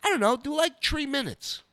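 high-pitched offhand comic star impression; Dice. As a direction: imitate him tossing out an answer, high-voiced. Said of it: "And he goes".

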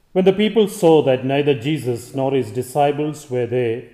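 loud, formal liturgical reading; solemn Gospel proclamation. Acted. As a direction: proclaim it from the pulpit.

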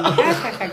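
high, loud spelled-out laugh; a loud put-on cackle; mock laugh. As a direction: belt out a fake ha ha ha. Quoting a laugh in the story.

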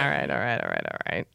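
dropping way down low; mock deep voice. Real, not acted. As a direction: sink the voice deep, playfully. Mock deep repeated all right.

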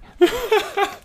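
very high squeal of laughter; squeaky laugh. Real, not acted. Laughing at own cute word.

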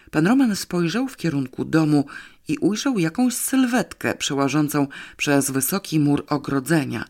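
plain reading voice

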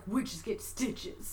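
slow, soft, very low menacing line; extremely deep bass voice. Pretend. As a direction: drop to the lowest voice you have. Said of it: "He's full on the lowest voice you could ever get".

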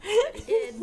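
giggling through the words; stifled giggles. Real, not acted. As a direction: fight back a giggle. Laughing about husband's reaction.

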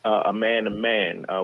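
ordinary delivery

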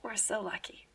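whispered, dry sarcasm; whispered sarcastic "so lucky". Acted. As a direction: breathe it out as a deadpan aside.

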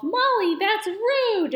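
high prim scolding doll voice. Retold or imitated. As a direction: scold her primly up high. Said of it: "Lunette is all".

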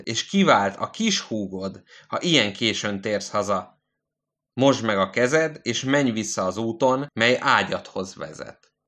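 usual speaking voice

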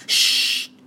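loud hissed shush; sharp shush. Acted. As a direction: hush her urgently. Hushing her at the window.

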